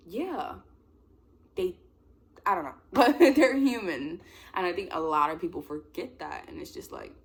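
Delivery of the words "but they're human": loud, high, laughing insistence; amused, drawn-out protest. Real, not acted. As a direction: laugh it out slowly. Laughs at own rambling.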